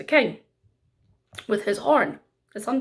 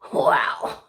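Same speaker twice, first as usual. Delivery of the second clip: awed whisper. A narrator's reading. King amazed by horn.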